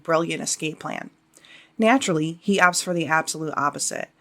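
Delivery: ordinary talk, nothing marked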